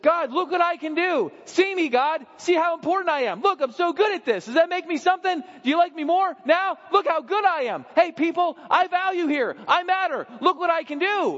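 high, loud, boastful pleading; needy child craving God's praise; attention-seeking. Acted. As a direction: show off to win God's favour. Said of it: "The illegitimate son or daughter says".

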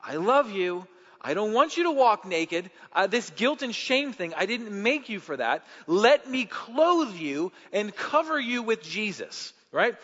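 preacher pressing the point to the congregation, full force; fiery preaching. Acted. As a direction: preach it out with conviction.

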